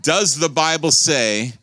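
high, loud pulpit challenge, slowing; loud preaching. Acted. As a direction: press the question on the church. Preaching at full force.

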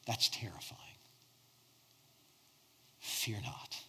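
hushed, unvoiced whisper. Real, not acted. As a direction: whisper it like a secret comfort. Preacher drops to whisper.

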